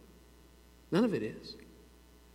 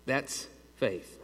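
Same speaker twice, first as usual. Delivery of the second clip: soft closing line; 7 dB quieter than usual. Real, not acted. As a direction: let "That's faith" fade out softly.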